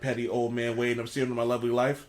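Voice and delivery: show host voice. Pretend. Host introduces his own show.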